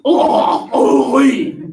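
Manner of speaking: high, loud hammed-up French "oh, oui"; mock French oui. Shown as a demonstration. Teacher clowning a French accent for students. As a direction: toss off a cartoon French sigh. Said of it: "as if you're attempting to puke".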